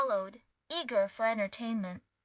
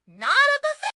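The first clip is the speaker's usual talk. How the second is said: exasperated; 12 semitones higher, 8 dB louder than usual. A narrator's reading. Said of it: "finally sighed and said".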